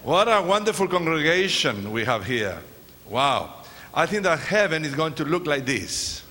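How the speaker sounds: normal talking voice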